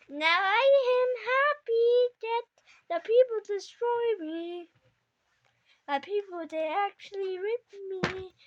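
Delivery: loud, high gloating proclamation; triumphant octopus. Pretend. Octopus boasting of beating its creator, triumphant play voice. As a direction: declare the victory loudly, stretching the words.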